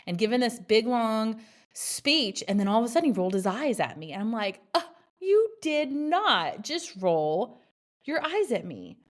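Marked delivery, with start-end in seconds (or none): 4.7-9.0 indignant mom. Retold or imitated. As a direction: stretch it out in disbelief at the eye roll. Retold outrage at son's eye roll.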